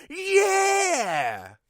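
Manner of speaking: high, loud cartoon cheer; eager shout. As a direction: yell it like a pumped-up kid. Jeff eagerly accepts heroism.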